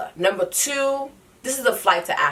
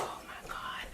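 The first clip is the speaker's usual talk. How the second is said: breathless whispered oh my God; whispered panic; 20 dB quieter than usual. Retold or imitated. Stuck in a taxi, late for her flight, she whispers in panic.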